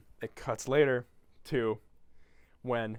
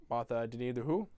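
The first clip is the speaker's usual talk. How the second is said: ordinary talk, nothing marked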